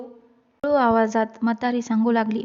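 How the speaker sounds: ordinary delivery, nothing marked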